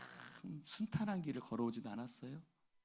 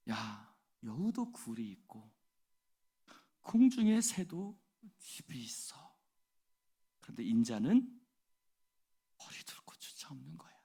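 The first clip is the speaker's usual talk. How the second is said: Jesus speaking; 7 dB louder than usual. Acted. Jesus voiced, quoting scripture.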